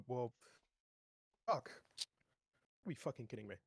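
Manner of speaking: ordinary delivery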